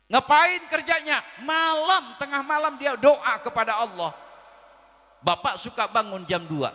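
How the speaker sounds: high, loud preacher's call to the crowd; preaching at full force. Acted. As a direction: belt it out over the hall. Preacher shouting to congregation. (berceramah dengan suara lantang)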